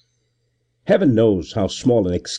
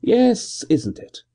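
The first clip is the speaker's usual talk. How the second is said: high hearty assent; a gentleman cheerfully humouring the constable; bright, hearty agreement; 12 semitones higher, slower than usual. A narrator's reading. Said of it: "Gervase assented in his heartiest tone".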